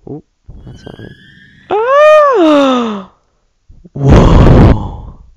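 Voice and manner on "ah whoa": high, loud yelp and gasp; startled scream. Real, not acted. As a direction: scream in sudden fright. Startled by something scary in game.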